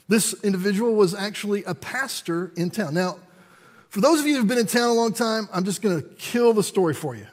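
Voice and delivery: normal talking voice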